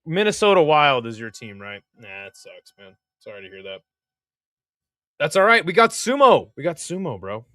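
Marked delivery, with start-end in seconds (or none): 5.2-6.5 louder, higher burst of excitement; hyped up. Real, not acted. Streamer hyping chat after his hockey team lost, excited. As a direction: pump up the chat about sumo.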